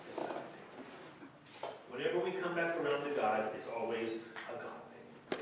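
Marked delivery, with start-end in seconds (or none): 0.1-1.7 whispered emphasis. Real, not acted. 4.5-5.4 whispered emphasis. Real, not acted.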